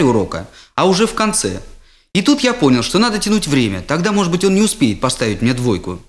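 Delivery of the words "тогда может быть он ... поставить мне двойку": plain reading voice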